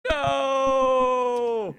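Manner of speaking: long, loud, high "no" held out; hammed-up wail of defeat, stretched out; anguished cry. Dad hit by another dad's joke, hammed-up anguished shout.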